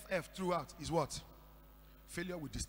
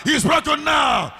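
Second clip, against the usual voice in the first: preached shout; 9 semitones higher, 24 dB louder, slower than usual. Real, not acted. Preacher declaring deliverance.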